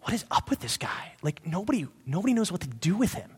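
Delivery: hushed whisper of puzzled onlookers; bystanders whispering. Retold or imitated. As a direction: lean in and whisper it like gossip. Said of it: "and they're going like".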